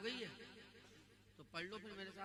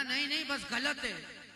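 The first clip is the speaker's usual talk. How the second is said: loud, slow, higher and flat-out rejecting; insistent denial; 6 semitones higher, 13 dB louder, slower than usual. Acted. Man rejecting the milad, loud and slow. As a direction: bang the table and refuse it. Acted argument about milad.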